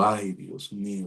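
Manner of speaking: slow, softened mock lament; retold dismay. Patient told his exam is very bad, dismayed. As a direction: draw out a mild, re-enacted groan of worry.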